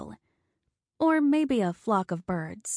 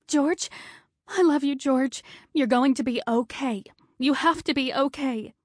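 desperate; 6 semitones higher than usual. Acted. Wife running beside the gurney, desperate and higher. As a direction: call after him as he is wheeled away.

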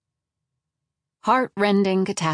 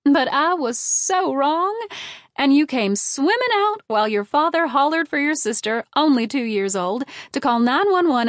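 sing-song mother; 7 semitones higher, 4 dB louder than usual. A narrator's reading. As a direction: lilt it up high like a proud mother telling her tale. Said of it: "Mom would continue in her sing-song voice".

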